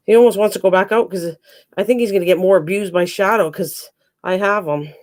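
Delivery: ordinary delivery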